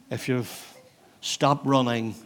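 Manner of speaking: ordinary talk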